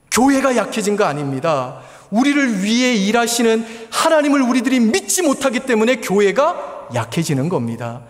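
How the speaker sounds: high, loud preaching build; fiery preaching. Acted. A pastor urging his congregation, loud fervent preaching. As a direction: preach it out with full conviction.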